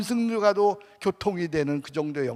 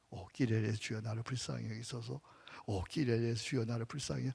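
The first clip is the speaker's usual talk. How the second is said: soft, lowered murmured plea; hushed prayer; 5 semitones lower, 11 dB quieter than usual. Shown as a demonstration. Preacher models a soft Kyrie prayer, hushed. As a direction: pray it softly, pleading for mercy.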